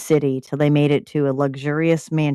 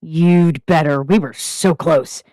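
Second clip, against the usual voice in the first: snapping; 3 dB louder than usual. Acted. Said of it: "Derek snapped".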